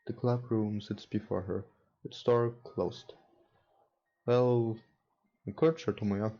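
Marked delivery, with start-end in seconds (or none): none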